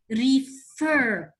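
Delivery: refer, with FER drawn out and strong; stress on second syllable. Shown as a demonstration. Teacher showing pupils where refer is stressed, second syllable stressed. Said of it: "what do you notice about the second syllable".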